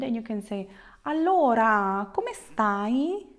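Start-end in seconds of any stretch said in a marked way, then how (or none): 1.1-3.2 drawn-out hello lifting into a question; friendly greeting. Shown as a demonstration. Teacher acts approaching a friend, friendly greeting. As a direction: greet them cheerily, stretching the first word. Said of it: "you're meeting a friend, you're approaching the friend".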